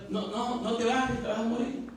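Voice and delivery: high, urgent plea to a friend; voicing Andrés anxiously pleading with Pedro; pleading warning. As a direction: beg him not to get out of the boat. Andrés begs Pedro not to step out.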